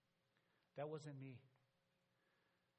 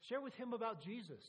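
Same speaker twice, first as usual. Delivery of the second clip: higher, louder barked order to the pastor; a soldier bossing the pastor, loud and higher; retold loud demand; 6 semitones higher, 5 dB louder than usual. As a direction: push the words out like an order from a soldier.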